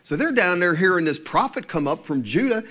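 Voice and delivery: ordinary delivery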